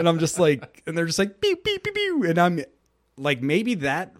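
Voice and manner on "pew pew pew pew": very high mock laser pews; blaster sound effects. Friends' video game blasts, imitated in a very high voice. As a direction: zap out the Star Wars laser sounds.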